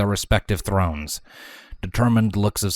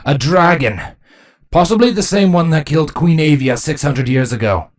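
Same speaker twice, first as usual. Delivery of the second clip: loud, raised soldier's voice; higher, louder alarm; urgent warning; 7 semitones higher, 6 dB louder than usual. Acted. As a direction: announce the threat with force. Captain announces the dragon.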